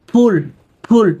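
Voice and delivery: ফুল with the h fused into the first consonant; aspirated consonant. Shown as a demonstration. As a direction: push breath through the start of ফুল. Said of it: "আগের শব্দের সাথে জোর দিয়ে".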